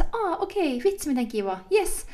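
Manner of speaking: high, gushing business-phone voice. Retold or imitated. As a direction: imitate overly nice PR gushing. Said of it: "och mjuk och på något sådär kämpande".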